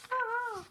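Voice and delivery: very high squealed "ah"; squeaky acted cry; high-pitched cry. Sergeant behind a wall under attack, high squeal.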